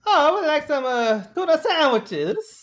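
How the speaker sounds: put-on high, dainty English accent; English accent, high-pitched and drawn out; mock English accent. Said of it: "What were they like?".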